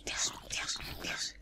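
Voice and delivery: very soft, anxious whisper; whispered exclamation. Real, not acted. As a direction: whisper it under your breath. Tense moment in game.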